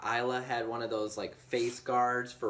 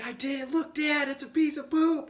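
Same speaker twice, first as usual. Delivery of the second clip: put-on much higher excited kid voice; proud kid voice; 14 semitones higher than usual. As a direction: play the proud little girl. Dad voicing proud daughter.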